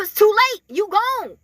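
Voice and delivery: ordinary delivery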